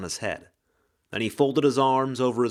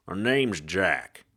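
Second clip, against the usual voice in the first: lower, slow tough-guy detective; hard-boiled ghost; 4 semitones lower, slower than usual. Acted. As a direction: drawl it like a noir gumshoe.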